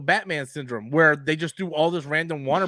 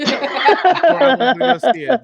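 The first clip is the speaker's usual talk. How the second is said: laughing; 6 semitones higher, 8 dB louder than usual. Real, not acted. Laughing while teasing friend.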